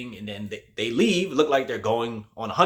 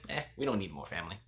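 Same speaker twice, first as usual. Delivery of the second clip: dismissive; 3 semitones lower, 11 dB quieter, faster than usual. Retold or imitated. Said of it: "very dismissive".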